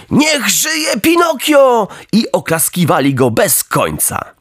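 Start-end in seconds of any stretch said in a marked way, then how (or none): 0.1-1.9 much higher rousing crowd cry; cheering shout. Acted. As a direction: hail the hero like a crowd of boys. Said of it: "zaczęli krzyczeć".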